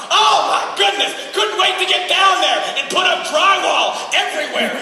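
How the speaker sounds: high, loud, excited gushing; hammed-up thrilled shouting; gushing eagerness. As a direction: gush as if you can barely wait.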